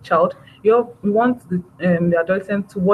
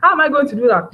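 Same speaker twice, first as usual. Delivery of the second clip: incredulous parent; 6 semitones higher, 3 dB louder than usual. Retold or imitated. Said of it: "it's going to be like".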